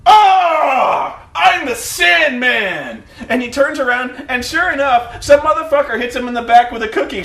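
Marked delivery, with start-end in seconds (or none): 0.1-3.0 high loud cry, then slow proud boast; triumphant pose shout. Retold or imitated. Sandman posing for the crowd, a loud slow boast. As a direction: ham up the big entrance. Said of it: "he poses. He's like".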